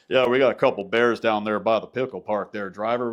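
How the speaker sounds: mocking a trucker's radio voice to his buddy. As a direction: play a trucker chatting on the CB. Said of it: "they, they change their voice".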